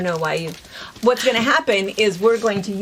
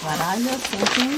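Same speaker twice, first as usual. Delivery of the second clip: put-on German accent. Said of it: "We don't know why we don't say thinking".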